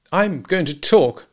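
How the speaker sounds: slow, high, loud insistence; stubborn insistence. A narrator's reading. Husband to sleepy wife, stubbornly insistent. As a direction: insist on every word.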